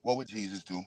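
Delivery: mock pious hush. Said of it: "Let me bring it back".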